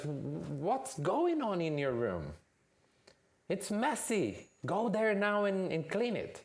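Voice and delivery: high, slow scolding mother voice; scolding mom. Acted. Mom scolds Junior about the messy room, high and stern. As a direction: nag the son to clean his room. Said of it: "she says".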